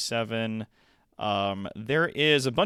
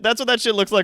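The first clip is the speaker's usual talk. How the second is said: fast, louder, pumped commercial read; hyped-up ad voice; 12 semitones higher, 7 dB louder, much faster than usual. Shown as a demonstration. Streamer dials his line up to eleven, fast and hyped. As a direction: crank it to eleven like a hype ad. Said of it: "when I dial it up to eleven".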